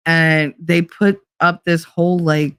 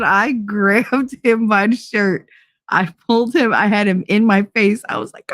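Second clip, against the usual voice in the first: ordinary delivery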